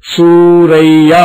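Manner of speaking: సూరయ్య with the య doubled and pressed hard, drawn out very slowly; stressed య. Shown as a demonstration. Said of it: "గట్టిగా అనాలి".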